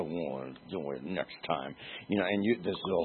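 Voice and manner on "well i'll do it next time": muttered aside. Retold or imitated. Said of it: "and you say".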